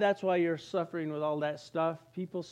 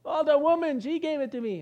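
high, louder blaming voice; whiny excuse; 8 semitones higher, 4 dB louder than usual. Acted. Adam blaming the woman to God, whiny. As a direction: pass the blame like a guilty kid. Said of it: "Adam answering God".

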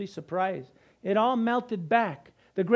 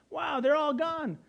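higher-pitched acted amazement; amazed discovery; 7 semitones higher than usual. Starving lepers finding the enemy camp empty, amazed. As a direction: act astonished, pitch lifted.

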